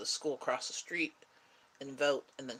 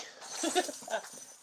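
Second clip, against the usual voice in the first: airy little laugh at the pet; high breathless giggle; 10 semitones higher than usual. Real, not acted. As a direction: giggle under your breath at the cat. Laughing at the cat.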